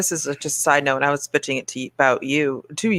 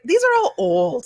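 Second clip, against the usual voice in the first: indignant; 11 semitones higher, slower than usual. Retold or imitated. Reading old reviews, indignant.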